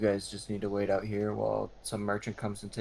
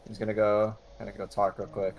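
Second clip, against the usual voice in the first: ordinary talk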